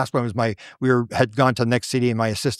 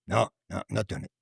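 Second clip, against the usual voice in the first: much softer low refusal; muttered refusal; 4 semitones lower, 9 dB quieter, faster than usual. Retold or imitated. She refuses the being, dropping low and soft. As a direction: mumble a stubborn no under breath. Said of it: "Da says to her".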